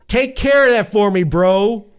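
loud, high tossed-off call; shouting across the room while tossing a jersey. Retold or imitated. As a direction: call it out as you throw.

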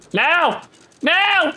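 urgent call. Real, not acted. Urging to drop anchor.

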